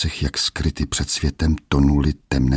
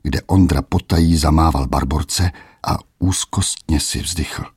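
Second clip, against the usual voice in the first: ordinary delivery, nothing marked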